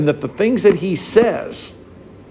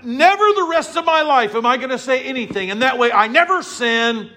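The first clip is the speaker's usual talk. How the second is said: high, pleased mock resolve; smug resolve; 7 semitones higher than usual. Preacher mimicking someone vowing never to speak. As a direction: announce the silly plan proudly. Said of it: "Someone goes".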